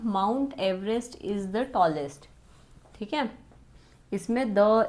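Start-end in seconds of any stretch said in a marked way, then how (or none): 0.0-2.3 Mount Everest is the tallest, read very slowly, no article before Mount; corrected version read slowly. Shown as a demonstration. Teacher reads the corrected sentence to the class, very slow. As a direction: read the fixed sentence at a crawl, starting straight on Mount. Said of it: "direct क्या आएगा?".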